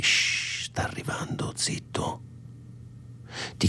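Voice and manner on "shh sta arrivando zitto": hushed urgent whisper; whispering while hiding. Retold or imitated. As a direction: whisper it so nobody hears. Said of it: "Ma prima dicevi".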